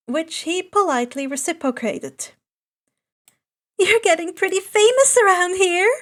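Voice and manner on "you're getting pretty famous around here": higher-pitched playful ribbing; teasing. Acted. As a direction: poke fun at him, a bit louder. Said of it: "Hatsume teased".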